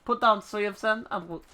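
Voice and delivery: laboured put-on French accent; bad French accent. Ishgardian instructor giving a chocobo lesson, bad French accent. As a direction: read it out in a hammy French accent. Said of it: "I'm so bad at this fucking accent".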